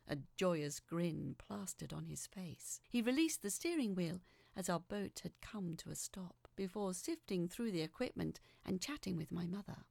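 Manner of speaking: ordinary delivery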